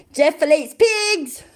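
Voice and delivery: high, drawn-out mock impression; amateur impression. Shown as a demonstration. Streamer having a go at an impression, high and drawn out. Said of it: "not very good at doing impressions".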